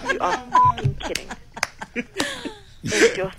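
high, laughing disbelief. Real, not acted. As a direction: crack up at the line. Breaks up at the ad copy.

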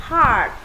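"Heart" with a long open A. Shown as a demonstration. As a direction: draw out the vowel of "Heart". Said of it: "âm A dài".

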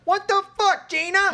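high, loud mock Travolta outburst; Travolta impression. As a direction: shriek it like a hammy Travolta.

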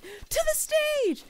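very high, loud, slow rallying cry; heroic cry. Retold or imitated. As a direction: call it out like a kid-show heroine. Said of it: "Allie ... is like".

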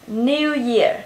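British "New" with a y-glide, nyoo; giọng Anh. Shown as a demonstration. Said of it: "ở cái âm yêu trong giọng Anh". ("New Year" giọng Anh, âm yêu)

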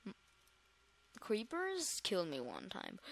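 ordinary delivery

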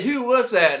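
mock call-out. Retelling the sergeant's shout.

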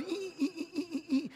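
high, soft repeated "ee" squeals; frightened squeaking. Retold or imitated. Boy about to fall off his bike, frightened squeaks. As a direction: imitate nervous little squeaks. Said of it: "so as I was".

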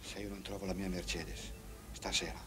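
very low, hushed; ominous ultimatum. Acted. Trumpeter warns friend about tonight, low and hushed. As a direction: set up a threat quietly. Quiet ultimatum before outburst.

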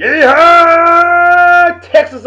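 stretched, high, loud yeehaw cry; a slow, loud, high cowboy whoop; hammed-up cowboy holler. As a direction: drag out the yeehaw like a rodeo whoop. Mock cowboy Yeehaw in shout-out.

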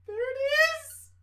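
excited cry. Real, not acted. Streamer cheering as the game screen appears, high and loud. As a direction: burst out as the title appears.

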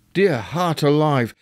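ordinary delivery, nothing marked

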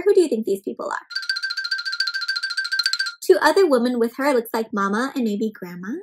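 usual speaking voice

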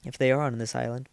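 usual speaking voice

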